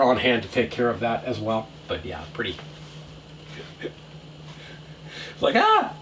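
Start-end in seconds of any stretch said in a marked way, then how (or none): 5.5-5.9 sudden high yelp of pain. Retold or imitated. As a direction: yelp like you just got burnt. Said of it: "Burnt the back of my hand".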